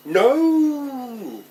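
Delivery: high, loud, drawn-out wail; screamed protest. Acted. Child dragged on and on to the school gate, long high scream. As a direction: hold a long howl that falls away.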